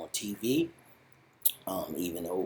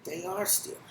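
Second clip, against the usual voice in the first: mild surprised realization; 10 semitones higher than usual. Retold or imitated. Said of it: "Marty Byrde is like".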